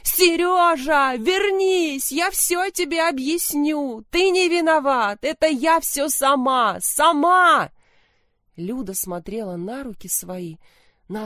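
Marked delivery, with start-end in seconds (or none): 0.0-7.7 high, loud, desperate screaming; crazed yelling. Acted. Milena screams madly after Sergei on the stairs. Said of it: "заорала безумно".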